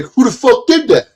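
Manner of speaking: high, loud angry demand. Retold or imitated. Rocker hit by powder demanding who threw it, angry high yell. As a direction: bark it at whoever threw it.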